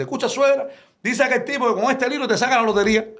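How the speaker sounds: high, teasing voice; sly punchline. Retold or imitated. Man slyly telling his mother-in-law about the book, high voice. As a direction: tease her with a sly lie.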